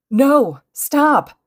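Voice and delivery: high, loud desperate plea; begging. A narrator's reading. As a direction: cry out, pleading for it to stop. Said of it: "she begged".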